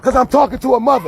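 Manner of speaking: high, loud, indignant; arguing with the guard outside church; heated protest. Real, not acted. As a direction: justify yourself to the crowd, voice up. Defending himself against accusers.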